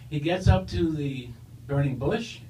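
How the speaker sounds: normal talking voice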